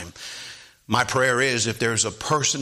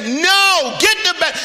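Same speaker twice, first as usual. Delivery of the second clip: very high, loud mother's shout; acted-out mom at full volume; yelling upstairs; 8 dB louder than usual. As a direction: shout the order up the stairs. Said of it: "yelled up".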